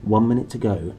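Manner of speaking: plain reading voice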